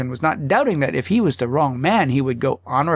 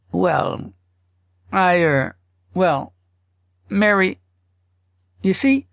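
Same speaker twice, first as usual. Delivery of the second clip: higher, halting husband, searching for words; hemming and hawing; 5 semitones higher, slower than usual. Acted. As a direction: stall and stumble, caught out by his wife. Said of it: "stop hemming and hawing".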